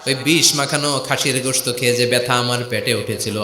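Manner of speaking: usual speaking voice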